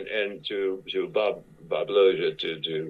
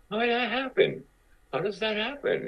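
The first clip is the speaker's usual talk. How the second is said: very high-pitched retold disbelief; mock incredulity. Reacting to co-star landing the coveted role, incredulous squeak. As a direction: squeak it out in comic bafflement.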